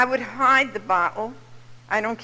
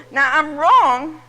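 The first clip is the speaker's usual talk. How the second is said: loud, slow, higher confession; emphatic admission; 6 semitones higher, 8 dB louder, slower than usual. Real, not acted. Speaker admitting her fault to the audience, loud slow emphasis. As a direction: land it hard and slow. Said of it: "emphatic admission".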